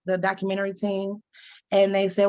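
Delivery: usual speaking voice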